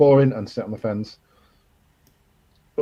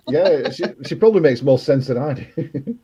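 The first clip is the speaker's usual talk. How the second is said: laughing; 4 semitones higher, 5 dB louder than usual. Real, not acted. Laughing at her own mum joke.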